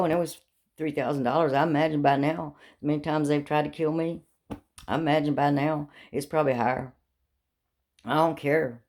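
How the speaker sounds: ordinary delivery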